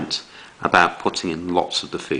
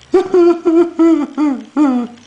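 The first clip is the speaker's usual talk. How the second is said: mock wailing sob; 7 dB louder than usual. Teacher acts crying bear.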